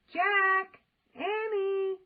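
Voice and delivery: loud high call across the yard; calling from afar. A narrator's reading. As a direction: call out to the kids. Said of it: "a voice called from the distance".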